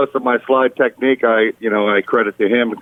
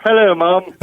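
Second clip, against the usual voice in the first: a light put-on British accent, pitched higher; mock British accent; 8 semitones higher than usual. Son calling his mother, in a mock British accent. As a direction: greet mom with a borrowed British lilt. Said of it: "all of a sudden my voice starts changing".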